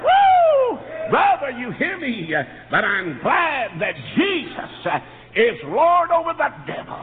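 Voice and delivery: whooping, high, loud revival preaching; exultant gospel shout with long-held words; full-force preaching. Real, not acted. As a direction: shout it out slowly to rouse the congregation. Preacher bursts into praise.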